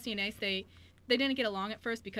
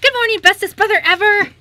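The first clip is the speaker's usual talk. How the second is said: put-on much higher, much louder childlike cheer; excited little girl; 21 dB louder than usual. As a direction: burst in as an overjoyed little sister. Jessie greets her brother.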